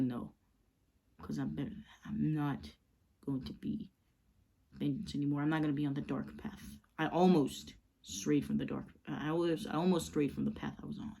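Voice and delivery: low, hushed and firm; quiet Bruce. Acted. Bruce refusing Slade, low and hushed. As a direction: say it softly but certain.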